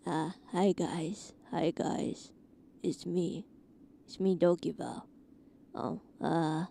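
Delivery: deep put-on low voice. Shown as a demonstration. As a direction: drop the voice low and quiet. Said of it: "can I do a low voice right now?".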